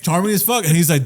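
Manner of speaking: ordinary delivery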